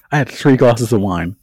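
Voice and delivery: ordinary delivery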